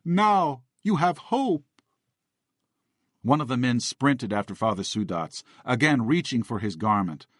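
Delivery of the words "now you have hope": high, slow tearful voice; men weeping over the boy in the chair. A narrator's reading. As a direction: weep it to the boy. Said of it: "they wept".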